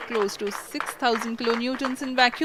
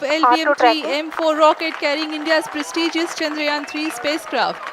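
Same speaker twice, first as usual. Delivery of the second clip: excited; 4 semitones higher, 5 dB louder than usual. Real, not acted. Live launch commentary at liftoff.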